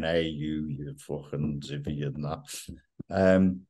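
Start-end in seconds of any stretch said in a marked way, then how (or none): 0.0-2.4 lightly hammed Irish voice, trailing off softer; mock Irish. Host jokes how Irish settlers talked, a rough Irish voice. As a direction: toss it off in a rough Irish accent. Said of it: "they started going".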